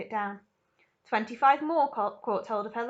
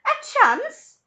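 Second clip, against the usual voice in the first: very high loud yell; high loud incredulous yell; 11 dB louder than usual. Acted. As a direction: yell in disbelief. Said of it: "yelled Missus Salt".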